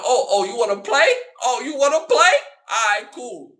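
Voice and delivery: high, loud mock challenge; taunting shout. Calling out the woman inching her car, loud taunt. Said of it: "I'm like".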